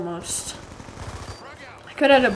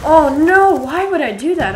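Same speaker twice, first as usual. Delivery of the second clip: louder and higher, yelling at own mistake; frustrated shout; 6 semitones higher, 9 dB louder than usual. Real, not acted. Gamer yelling at his own blunder, frustrated shout. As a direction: cry out in dismay at the screen. Botched a lightning strike streak.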